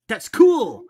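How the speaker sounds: high, loud and delighted; excited. Acted. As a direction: cheer it out. Character reacts in story.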